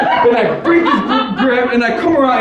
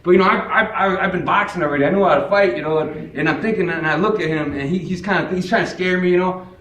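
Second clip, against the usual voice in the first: normal talking voice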